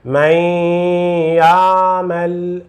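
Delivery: من يعمل with a long, level nasal double y; nasal doubled ya. Shown as a demonstration. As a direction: hold the merged y of من يعمل through the nose. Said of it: "duas vezes ya".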